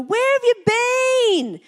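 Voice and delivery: high, drawn-out, thrilled greeting; acted happy surprise, stretched and high; delighted reunion greeting. Shown as a demonstration. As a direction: greet a long-unseen friend with a soaring squeal of surprise. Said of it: "you've run into someone that you haven't seen".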